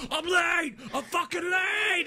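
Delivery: high, slow mock scream. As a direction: stretch each cry out in hammed-up rage. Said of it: "he's screaming".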